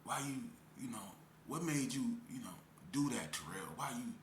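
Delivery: soft, low imagined concern; gentle questioning. Pretend. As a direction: ask softly, as a caring adult would. Voicing the question nobody asked him.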